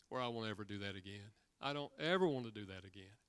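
remorseful husband. Retold or imitated.

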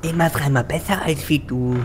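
a lightly disguised voice. Acted. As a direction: fake a different speaker. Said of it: "Das klang irgendwie gar nicht wie du".

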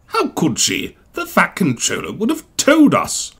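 huffy. A narrator's reading. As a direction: scoff at the very idea. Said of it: "huffed Gordon".

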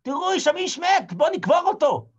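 high excited shouted alarm; people shouting. Acted. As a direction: shout like startled townsfolk. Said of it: "אני שומע אנשים צועקים".

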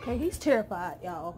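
normal talking voice

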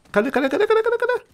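rising high-pitched cadê chant; playful high searching; high eager search. Real, not acted. Hunting enemies eagerly.

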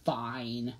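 low, soft resigned sigh; a grudging, sighed concession. A narrator's reading. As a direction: give in reluctantly, low and quiet. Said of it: "Sans sighed".

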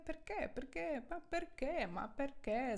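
mock Italian "ma perché"; mimicked Italian exclamation. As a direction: play an Italian asking why, over and over. Mimics Italians' typical perché with gesture.

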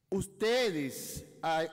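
normal talking voice

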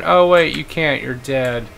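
ordinary talk, nothing marked

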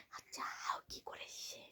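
slow, breathy whisper. Real, not acted. Told to talk softly, parent whispers to child. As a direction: ask it in a hushed whisper so the bogeyman won't hear. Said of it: "আস্তে আস্তে কথা বলতে হবে".

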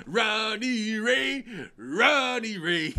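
"Ronnie Ray" sung slowly in place of [name]; slow sung "Ronnie Ray" mishearing; mock lyric. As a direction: stretch out "Ronnie Ray" like the chorus. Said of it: "Instead of saying [name]".

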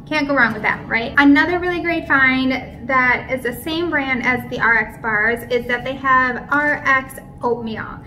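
usual speaking voice

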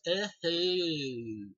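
lightly voiced Ernest grunt; imitating Ernest. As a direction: toss a silly catchphrase at the camera. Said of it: "he looks at the camera and goes".

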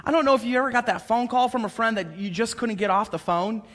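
ordinary talk

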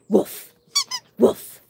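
loud playful woof imitation; mock bark. Owner barking at the dog to coax it, playful woof.

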